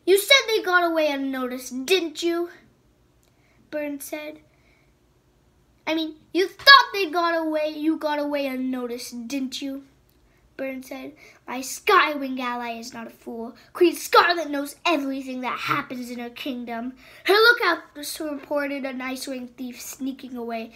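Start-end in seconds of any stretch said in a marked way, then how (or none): 0.1-2.5 loud, higher taunting question; loud mocking interrogation; menacing taunt. Queen interrogating the prisoner. 5.9-9.9 mocking pressure. Queen pressing the prisoner. 11.5-20.7 cold threat. Acted. Queen lecturing her captive.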